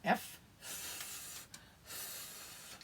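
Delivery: "F, F" as a breathy unvoiced fff; f sound. Shown as a demonstration. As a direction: push air through teeth for "F". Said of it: "So D is D".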